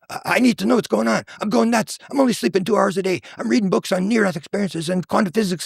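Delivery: imitating a worked-up, sleepless man; talking a mile a minute. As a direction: pour it out like someone going nuts. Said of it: "he's just talking a mile a minute".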